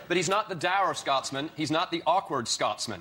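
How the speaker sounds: slowly drawn-out Bush impression; slow mock Bush. Panellist quoting Bush slowly at the press conference. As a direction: drag each word out like Bush. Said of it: "followed it with".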